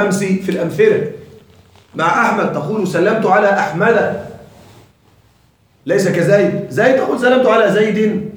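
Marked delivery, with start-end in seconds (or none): 2.9-4.3 "أحمد" ending as "Ahmada"; fatha genitive ending. Shown as a demonstration. Teacher modelling the diptote genitive, "أحمد" said with final "-a". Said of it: "هذا يجر بالفتحة". 7.2-8.3 "زيد" ending as "Zaydin"; kasra with tanween. Shown as a demonstration. Teacher contrasting Zayd for students, "زيد" said with "-in" ending. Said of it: "كسرة مع التنوين".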